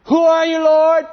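high, loud call into the sky; startled cry. Acted. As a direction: cry out to an unseen voice. Saul cries back to the voice.

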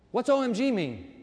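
normal talking voice

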